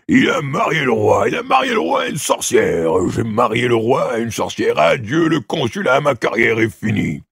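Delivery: loud, high furious rant; a father bellowing that his career is ruined; furious yelling. A narrator's reading. Father storming in, yelling furiously about the king's marriage. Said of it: "le pas furieux de son père qui, qui s'époumonnait".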